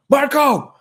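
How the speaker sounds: loud, high shout of "Marco"; excited shout, pitched high; exclaimed name. Retold or imitated. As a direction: yell for your flatmate at two AM. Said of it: "I exclaimed his name".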